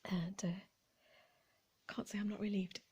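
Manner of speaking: whispered aside. Real, not acted. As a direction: drop to a secret whisper. Wry aside about hipster beards.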